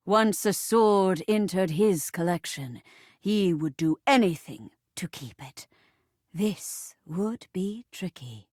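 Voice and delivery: very low, ominous storyteller voice; dramatic story narration. Acted. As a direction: narrate it deep and weighty. Narrates the play.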